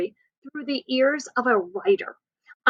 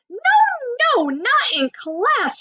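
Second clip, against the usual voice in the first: a hint of boom, slow and higher; booming teacher; 12 semitones higher, slower than usual. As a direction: stop the class with a stern slow boom. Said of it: "she boomed".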